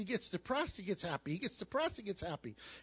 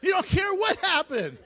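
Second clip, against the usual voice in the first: joyful shout; 9 semitones higher, 8 dB louder than usual. Acted. Jet ski joy acted out.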